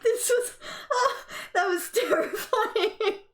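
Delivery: high, laughing half-crying swearing; shaky laughter. Real, not acted. Gamer recovering from fright, laughing and near tears. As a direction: laugh off the terror. Shaken laughter after the scare.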